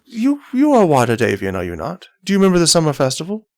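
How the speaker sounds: lightly voiced captain, probing; a hint of a grand captain jogging someone's memory; courtly captain. Captain questioning a player about the festival, lightly voiced captain.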